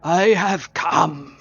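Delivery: slow, grand roll call of titles; stately, stretched proclamation; mummy herald. Acted.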